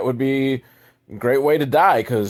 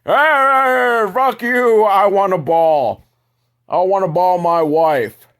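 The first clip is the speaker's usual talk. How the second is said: caveman men; 7 semitones higher, 5 dB louder, slower than usual. Acted. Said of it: "jump to the".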